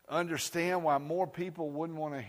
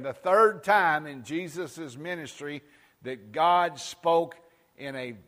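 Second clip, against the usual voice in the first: ordinary talk, nothing marked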